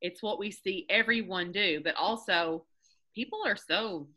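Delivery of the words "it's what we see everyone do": ordinary talk, nothing marked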